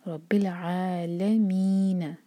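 रब्बिल आलमीन very slow, आ held two counts; recitation demo. Shown as a demonstration. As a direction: draw out the long आ in रब्बिल आलमीन. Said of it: "दो हरका लंबा". (रब्बिल आलमीन लंबा खींचकर)

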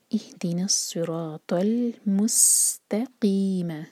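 इहदिना सिरातल मुस्तकीम very slow, ल sounded; recitation demo. Shown as a demonstration. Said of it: "ये pronounce किया जाता है". (इहदिना सिरातल मुस्तकीम धीरे-धीरे)

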